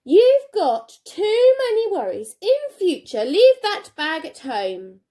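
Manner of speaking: high, loud, slow cross teacher. Acted. Teacher scolding Jenny for being late, high and cross. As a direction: tell her off like a stern teacher. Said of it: "what she would say".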